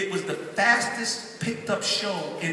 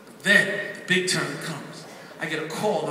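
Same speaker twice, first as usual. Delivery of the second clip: usual speaking voice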